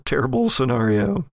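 ordinary talk

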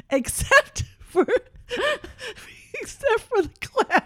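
high-pitched laughing through the words; can barely get it out for laughing. Real, not acted. Mom retelling the clown story, laughing hard.

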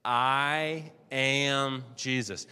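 "I am Jesus" drawn out very slowly, weight on "I"; slow together. Shown as a demonstration. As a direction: lead the room, very slow. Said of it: "Let's say that together".

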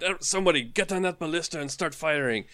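high, urgent captain's orders; giving orders to the crew; barking orders. Acted. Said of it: "just start giving orders".

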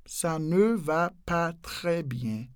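"Ça ne va pas très bien" very slowly, each word apart. Shown as a demonstration. Teacher breaking the sentence down slowly for learners.

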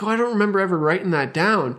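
a light send-up of the brother's innocent answer; imitating sheepish brother. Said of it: "It's like".

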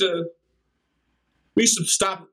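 normal talking voice

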